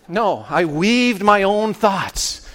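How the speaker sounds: usual speaking voice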